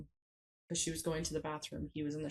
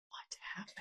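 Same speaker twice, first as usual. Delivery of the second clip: hushed, breathless "what happened"; shocked whisper; 11 dB quieter than usual. Retold or imitated. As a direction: barely voice it in disbelief. Shocked at mother's sudden aging.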